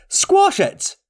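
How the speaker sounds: shouted command, pitched very high. A narrator's reading. Second hunter urging the others to crush the creature, high shout. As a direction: yell it high like an urgent order. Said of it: "and another shouted".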